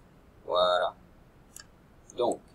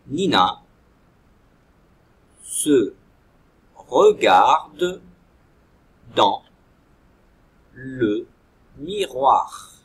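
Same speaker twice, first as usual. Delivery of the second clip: each word "Nina se regarde dans le miroir" separated and louder; dictation pace; 13 dB louder, much slower than usual. Shown as a demonstration. Teacher dictating the sentence to children, loud and spaced.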